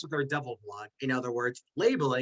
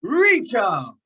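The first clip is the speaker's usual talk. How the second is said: loud, high call of the name; proud reveal of the top pick; excited announcement; 6 semitones higher, 8 dB louder than usual. Real, not acted. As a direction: announce the winner with a flourish. Announcing the winner.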